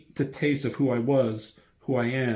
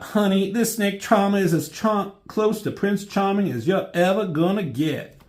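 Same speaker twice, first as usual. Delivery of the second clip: high-pitched sassy voice, louder, dripping with attitude; sassy retort; 9 semitones higher, 4 dB louder than usual. Retold or imitated. Reviewer's own sarcastic reply to Gambit, high and sassy. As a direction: toss it off like a diva calling someone out. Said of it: "I thought".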